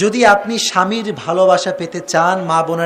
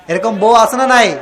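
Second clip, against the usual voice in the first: normal talking voice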